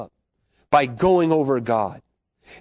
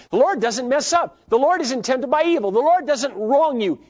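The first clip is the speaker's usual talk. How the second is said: high, lifted proclamation; joyful praise; 10 semitones higher than usual. Real, not acted. Preacher exulting in God's righteousness, raised. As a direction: lift each line up with joy.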